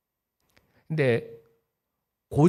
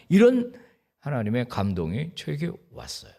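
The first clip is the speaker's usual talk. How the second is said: ordinary talk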